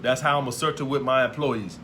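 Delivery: ordinary talk, nothing marked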